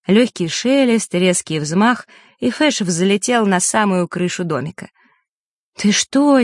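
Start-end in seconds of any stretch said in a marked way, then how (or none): 5.8-6.4 higher-pitched startled question; a touch of alarm, voice jumping up; startled, mildly scared reproach; frightened. A narrator's reading. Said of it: "испугалась Василиса".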